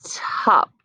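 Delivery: hushed blended word; whispered word. Shown as a demonstration. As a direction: breathe the word out unvoiced. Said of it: "One more time".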